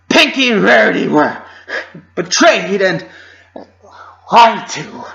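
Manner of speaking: high, loud, still raging; loud angry voice. Acted. Twilight accusing the group, loud and furious. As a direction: hurl the words out, shaking with anger. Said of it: "voice trailed off".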